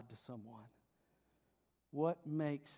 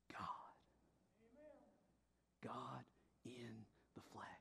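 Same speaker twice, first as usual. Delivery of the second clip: very soft, low, with weighty pauses; hushed reverence; 3 semitones lower, 17 dB quieter than usual. Real, not acted. Preacher answering who Jesus is to the congregation, hushed reverence. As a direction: drop to a near-whisper.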